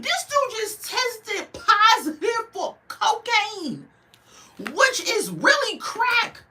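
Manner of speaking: high-pitched outraged rant; indignant ranting at the screen, voice way up; heated rant. Real, not acted. Ranting about the crackhead boyfriend.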